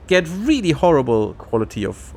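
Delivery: usual speaking voice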